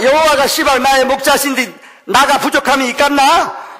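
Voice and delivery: "여호와가" pressed high and loud, Jeolla sing-song on "시방"; Jeolla dialect. Pretend. Pastor preaching Psalm 23 to the congregation in Jeolla dialect. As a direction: declaim it in thick Jeolla dialect. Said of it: "마지막 전라도면".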